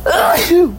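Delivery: loud real sneeze; a sudden, explosive sneeze; sneezing. Real, not acted. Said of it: "Why do I got the sneezies?".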